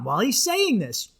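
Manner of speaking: ordinary delivery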